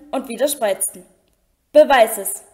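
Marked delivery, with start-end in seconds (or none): 1.7-2.4 Malfoy hisses the dare at Dumbledore, louder; hissed challenge. A narrator's reading. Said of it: "zischte er".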